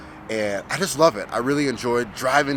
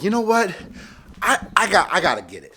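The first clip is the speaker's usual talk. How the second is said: excited; 8 semitones higher than usual. Retold or imitated. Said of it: "And I was like".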